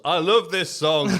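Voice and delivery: mock crowd yell. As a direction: call it out like one lone fan in the crowd. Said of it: "one guy in the crowd loudly yells".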